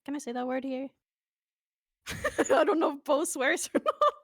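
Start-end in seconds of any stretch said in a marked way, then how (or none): 2.1-4.1 laughter breaking into the words, louder; laughing. Real, not acted. Guest unsure if Bo swears on stream, laughing. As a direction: talk through giggles.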